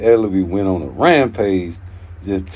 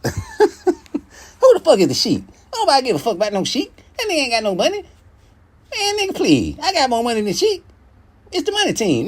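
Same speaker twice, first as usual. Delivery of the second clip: much higher, fast put-on voice with laughing start; mock a boss brushing off a rival; Leonard Ellerbe impression; 11 semitones higher than usual. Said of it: "That's my Leonard Ellerbe voice".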